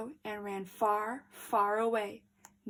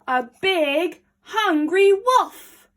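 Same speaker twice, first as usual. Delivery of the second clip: louder, higher and slowed on hungry wolf; big spooky announcement; dramatic reveal; 8 semitones higher, 10 dB louder, slower than usual. A narrator's reading. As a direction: boom out the threat.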